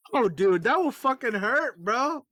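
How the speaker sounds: high, loud fanboy wince; shocked reaction. Retold or imitated. Retold wincing reaction to comic panel.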